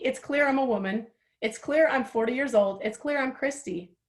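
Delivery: ordinary talk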